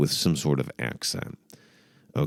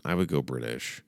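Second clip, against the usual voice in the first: ordinary talk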